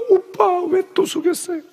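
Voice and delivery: 우는 척하며 징징대듯; mock crying Delilah. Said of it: "또 울어요". (훨씬 높은 목소리로 우는 들릴라 흉내)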